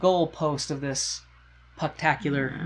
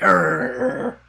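low grumbling growl; [name] growling at Eve and Marco, low; mock grumpy growl; 10 semitones lower, 4 dB louder than usual.

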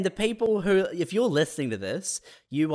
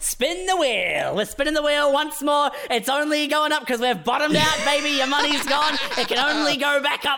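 high-pitched carnival-barker sales push; manic hype; 10 semitones higher than usual. Acted. Broker urging a broke client to reinvest, manic. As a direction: keep the gambler spinning, unstoppable.

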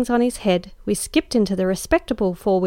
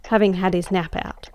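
plain reading voice

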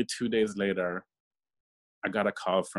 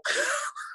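laugh. Real, not acted. Laugh heard.

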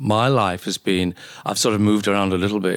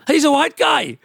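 very high, incredulous exclamation; high-pitched exclamation. Real, not acted. Doctor exclaims about a cartoon's voice actor, high-pitched. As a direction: jump high in pitch to point it out.

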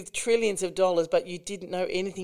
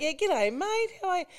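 high-pitched, hammed-up Australian g'day; broad Aussie greeting; 13 semitones higher than usual. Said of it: "when you hear an Australian accent".

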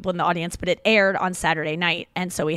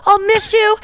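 much higher and louder, a cartoon mole crying out; tearful mole goodbye; 13 semitones higher, 7 dB louder than usual. Host voicing a mole chasing the train, high and loud. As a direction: call after the train like a tiny heartbroken mole. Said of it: "one running after the train being like".

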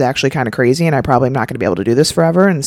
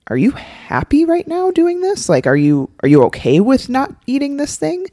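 high-pitched, rising, doubtful questions; worried, probing query rising high; concerned questioning; 10 semitones higher than usual. Acted. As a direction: gently check on a loved one, pitch climbing. Acting concerned loved one questioning.

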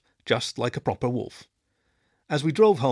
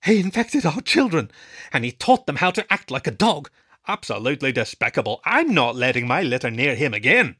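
indignant parent; 2 semitones higher, 5 dB louder than usual. Acted. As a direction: complain hotly. Angry parent complaining.

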